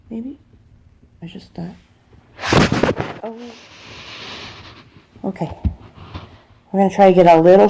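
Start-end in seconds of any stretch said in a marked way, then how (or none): 0.1-5.6 whisper. Real, not acted. Whispering while clipping.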